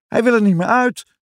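put-on very high, worried child's call. A narrator's reading. Jip who cannot get the bead out, calling out. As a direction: call it out high, as a stuck little boy. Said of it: "roept Jip".

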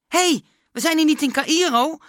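high, loud startled protest; startled cry. A narrator's reading. Klaas protesting at the teacher's sudden braking, startled shout. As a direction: call out in alarm. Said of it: "riep Klaas geschrokken".

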